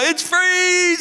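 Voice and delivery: high, slow squeal of a Brit hitting cold water; shocked cold swimmer. Retold or imitated. British swimmer at a cold beach, squealing high and slow. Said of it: "they go".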